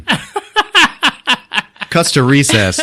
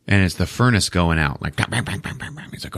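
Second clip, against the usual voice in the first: ordinary talk, nothing marked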